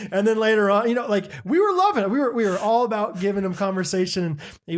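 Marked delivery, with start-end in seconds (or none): none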